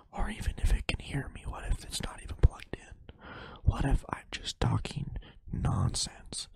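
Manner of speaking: hushed, voiceless whisper. Pretend. Podcaster wondering if the mic is even on, whispering. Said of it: "now I'm whispering".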